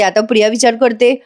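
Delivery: ordinary talk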